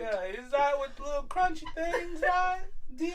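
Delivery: folksy Southern question, rising at the end; mock Southern accent. Diner asks server about the tacos, mock Southern accent.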